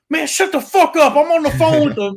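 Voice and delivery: high, loud put-on voice of a harried mom; yelling mother. Caller mom yelling at her kids mid-call, high and loud. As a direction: bark it at the kids, shrill-pitched and loud. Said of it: "and then her going".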